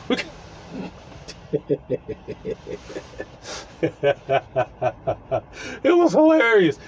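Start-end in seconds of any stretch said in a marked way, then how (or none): 1.5-3.2 wheezing laugh with no voice; breathless silent laugh. Real, not acted. Laughing at own story. 3.8-5.4 soft breathy laugh bursts; helpless laughter. Real, not acted. Laughing at own story.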